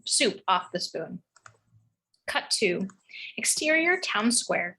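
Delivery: plain reading voice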